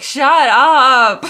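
loud, high exasperated yell with laughter; drawn-out shout at an annoying character; out-loud outburst. Retold or imitated. Annoyed viewer yelling at the whiny suspect, loud outburst. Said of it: "like, out loud".

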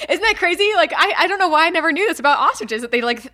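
high, loud excited rush; wound-up, incredulous excitement; amazed. Real, not acted. Amazed at a newly learned fact.

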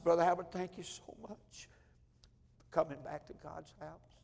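soft, breaking gratitude; nearly in tears, thank him quietly; hushed, emotional thank-you; choked thanks. Retold or imitated.

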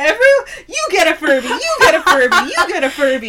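high, loud, laughing announcer shout; hammed-up giveaway. Aunt giving every child a Furby, laughing and high. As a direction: hand them out like a game show host.

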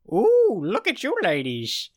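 high, slow, loud catcall; drawn-out mock old-man whoop; leering old man. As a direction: hoot at them like a cheeky old geezer. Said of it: "He goes".

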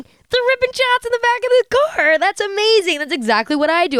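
hammed-up thrilled reaction; re-enacted excitement. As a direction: act out your amazed younger self, pitch way up at first. Re-enacts own delighted younger reaction.

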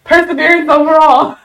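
words squeezed out through laughter; laughing. Real, not acted. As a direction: laugh as you say it. Laughing at own story.

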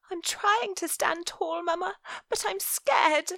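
high, small frightened voice; a child holding back tears; scared little girl. Acted. Viola scared, talks to Mama.

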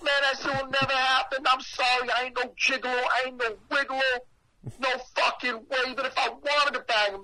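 high-pitched, worked-up refusal; appalled rant. Retold or imitated. Storyteller refusing the older woman's advance, appalled rant. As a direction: protest high and hard, piling up objections.

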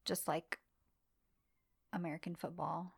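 soft unvoiced whisper; a quick whispered afterthought; whispered aside. Real, not acted.

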